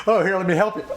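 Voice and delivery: high, loud mock-helpful tease; mock teasing. Friends mocking his help, high sing-song tease. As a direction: imitate friends ribbing him. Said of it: "They ribbed me for".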